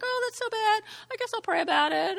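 high, quick, offhand shrug of a voice; mock dismissive. Pastor mimics a careless listener brushing off bad news, mock offhand. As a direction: toss it off like it barely matters. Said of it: "And he's like".

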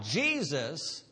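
ordinary delivery

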